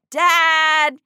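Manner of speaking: put-on high, loud child's call for Dad. A narrator's reading. Mia calling for her dad, high and loud. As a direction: shout into the house for her father.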